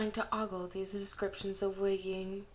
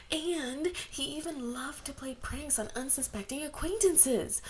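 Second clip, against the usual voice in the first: put-on high, dreamy swooning girls; gushing fangirls; 6 semitones higher than usual. A narrator's reading. Crowd of girls swooning over Wei Ying, high and dreamy. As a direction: gush like smitten teenage girls.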